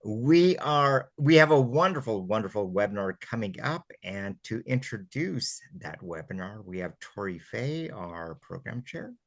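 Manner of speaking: usual speaking voice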